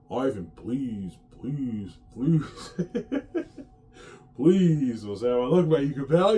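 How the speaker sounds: slow drawn-out pleading that climbs on the last please; beg, stretching each please out; mock begging. Actor begging Ivan for a role, slow and pleading. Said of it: "they're begging hard".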